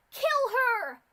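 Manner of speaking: very high, very loud murderous scream; furious scream. Acted. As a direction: scream the kill order. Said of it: "she screamed".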